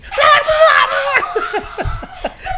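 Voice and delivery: very high shrieking laugh. Real, not acted. Drunk and giddy, letting out a squealing laugh. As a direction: let out a wild squealing laugh.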